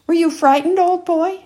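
jovial father teasing his son. Acted. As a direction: rib the boy with a grin in the voice. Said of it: "he teased Henry".

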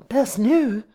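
high, loud astonished echo; gasping. A narrator's reading. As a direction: gasp the words in disbelief. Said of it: "began Miss Curley with a gasp".

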